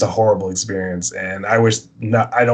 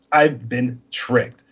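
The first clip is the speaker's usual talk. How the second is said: slow repeat; 3 semitones higher, much slower than usual. Retold or imitated. Repeats it after buddy asks what.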